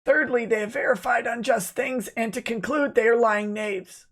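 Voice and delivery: high mocking impression of a lawyer reciting charges; mocking Lemoyne voice. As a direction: ham up a pompous lawyer. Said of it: "I will be doing Lemoyne's voice".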